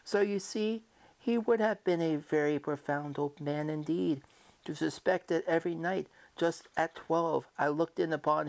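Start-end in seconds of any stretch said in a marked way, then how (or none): none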